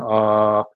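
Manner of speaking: sustained ah. Shown as a demonstration. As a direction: hold a steady open ah. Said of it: "That means when I'm saying".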